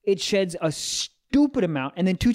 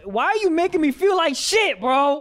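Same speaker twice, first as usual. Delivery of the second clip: high, whiny exasperation; high-pitched, wounded complaint; exasperated protest; 11 semitones higher, 4 dB louder than usual. Real, not acted. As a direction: break off and protest, voice shooting up. Teased by friend, protests mid-story.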